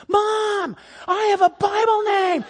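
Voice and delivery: put-on high-pitched kid holler; excited boy calling out. Boy telling his mom about his name, excited call. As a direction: imitate a child announcing big news.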